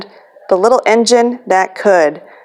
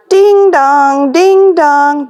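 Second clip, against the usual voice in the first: louder, higher bell call; train bell; 5 semitones higher, 3 dB louder, much slower than usual. A narrator's reading. Storyteller ringing the train bell for kids. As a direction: ring it out like a bell.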